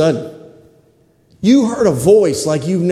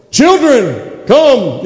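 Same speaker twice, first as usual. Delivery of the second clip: grand, raised summoning voice; archangel's call; 4 semitones higher, much slower than usual. Acted. Archangel summoning the children, grand calling voice. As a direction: call out as a heavenly herald. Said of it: "have an archangel go".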